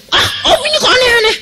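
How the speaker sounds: put-on high, fast girlish voice; young woman. Young woman teasing the pastor, high and fast. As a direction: squeal it like a flattered young woman.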